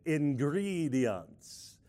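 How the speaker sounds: very slow, drawn-out cartoon vampire count. Shown as a demonstration. Showing the congregation the word in a count-like voice. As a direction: stretch it out in a mock vampire accent. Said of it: "in a count-like voice".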